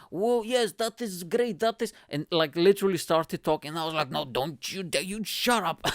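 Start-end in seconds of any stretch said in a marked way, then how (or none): 0.1-1.9 high-pitched mock Eastern European heckler; Eastern European accent. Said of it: "and she was like". 4.1-5.9 mock scolding. Retold scolding of a heckler.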